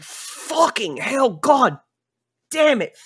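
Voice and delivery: high, strained choked cursing; disgusted NPC gagging. Acted. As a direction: recoil from the awful smell. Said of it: "he's like".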